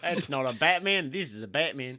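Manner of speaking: Aussie tough-guy parody, amused; mock Australian accent. Co-host riffing on a Batman Australia, playful Australian accent. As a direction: do a jokey Australian boast. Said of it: "how is there no Batman Australia yet?".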